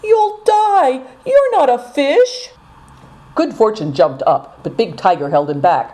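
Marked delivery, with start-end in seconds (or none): 0.0-2.5 high, slow frightened plea; pleading girl's cry. A narrator's reading. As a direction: beg him to stay out of the water. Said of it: "cried Seven Stars entreatingly".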